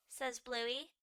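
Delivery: plain reading voice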